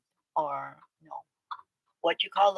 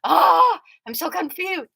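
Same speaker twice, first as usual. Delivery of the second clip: high, loud, baffled voice; bewildered outburst; mock confusion; 10 semitones higher, 10 dB louder than usual. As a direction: play up the confusion. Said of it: "I'm like".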